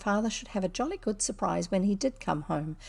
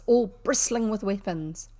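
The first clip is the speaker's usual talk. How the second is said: ordinary delivery, nothing marked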